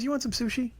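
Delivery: soft, high imitated voice; hushed high-pitched friend impression; friend's gentle suggestion.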